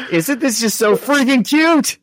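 very high-pitched delighted gush; gushing squeal. Real, not acted. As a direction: squeal it like a giddy fan. Gushing over the comic's teen romance.

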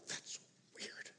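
breathy whispered aside, half-joking; mock whisper. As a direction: whisper it like a puzzled newcomer. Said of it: "It was like".

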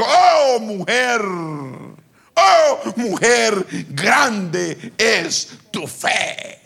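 long swooping high "oh", slow finish; awed exclamation. Retold or imitated. Jesus marvelling at the woman's faith, exclaiming. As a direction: marvel aloud, drawing out each phrase.

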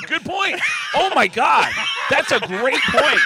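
high, loud shouting through laughter; real helpless-laughter exclamation; laughing agreement. Real, not acted. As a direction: cry out, cracked up by the joke. Laughing agreement at co-host's jab.

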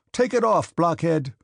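high, loud, irritated order; angry shout. A narrator's reading. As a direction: yell it angrily at a bungling servant. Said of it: "he shouted loudly and angrily".

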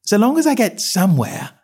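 put-on high, bright little girl; eager young Alice. A narrator's reading. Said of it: "Alice added".

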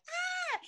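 very high squeal of joy; high delighted squeal; excited shriek. Retold or imitated. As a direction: let out a thrilled high squeal. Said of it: "And I'm like".

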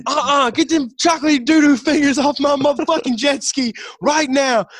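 high, loud comic rant; imitated angry yell. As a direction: order them off, loud and hammed-up. Acts out show character's angry order.

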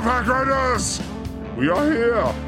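ordinary talk

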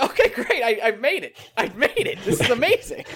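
gleefully fake-thrilled; laughing sarcastic reply to a troll; laughing mock delight. Mock delight at being insulted.

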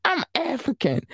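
a light mock of her own earlier certainty; retold proud claim. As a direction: replay what you told your mother, lifted and soft. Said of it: "let me tell y'all what I, what I told her".

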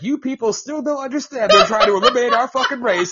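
high, loud, laughing retold rant; mock outraged rant. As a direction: rant his warning through laughter. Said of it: "He's like".

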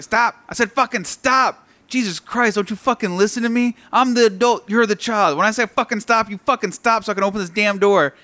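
loud, high retold scolding; angry yelling grandpa. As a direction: imitate an old man yelling at his grandson. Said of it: "he's yelling at his grandson".

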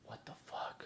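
hushed, voiceless disbelief; stunned whisper. Retold or imitated. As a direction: whisper it to yourself, appalled. Stunned aside watching her load rounds.

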